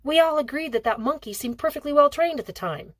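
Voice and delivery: repeating Hale's words. A narrator's reading. Kat repeating Hale's excuse to her father, lightly mocking. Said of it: "repeating Hale's words".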